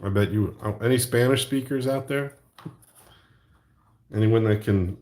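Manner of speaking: usual speaking voice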